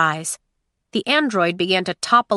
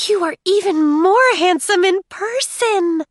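high, louder, swooning robot; smitten android voice; 11 semitones higher, 6 dB louder than usual. Acted. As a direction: gush like a lovestruck robot. Smitten android flatters the prince.

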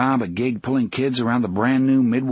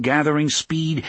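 ordinary delivery, nothing marked

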